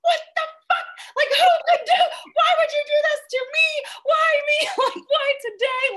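shrieking high and loud in shocked outrage; panicked, fast disbelieving shout; retold meltdown. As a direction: yell it like the world has betrayed you. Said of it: "meltdown 'cause I was so shocked".

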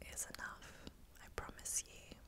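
ordinary talk, nothing marked

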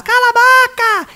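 high, stretched shout; mom shouting. Retold or imitated. Mother yelling at the noisy parrot, high stretched shout. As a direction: snap it out like an impatient mother. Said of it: "Gritava lá dentro de casa".

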